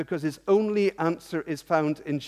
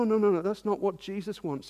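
ordinary talk, nothing marked

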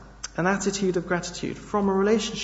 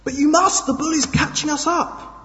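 desperate urging; 11 semitones higher than usual. Acted. Man begging John to pray as the bull gains, frantic. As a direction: urge him on in desperation.

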